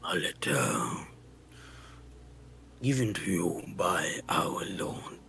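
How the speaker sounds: deep whispered croak; low, hoarse giant whisper; croaky voice. Acted. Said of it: "croaky voice".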